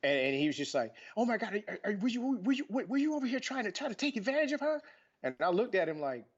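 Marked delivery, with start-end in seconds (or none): 1.2-4.8 high, rising, shocked accusing voice; a hammed-up outraged friend; mock alarmed accuser. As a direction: play the frantic friend crying foul. Friend accusing him, rising panic.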